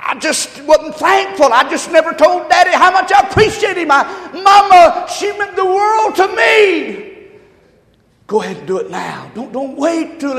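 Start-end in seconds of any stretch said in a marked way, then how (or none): 0.1-7.1 loud, high cry of regret, slowing down; anguished regret. Retold or imitated. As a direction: wail it like a mourner's lament. Voicing grieving young people.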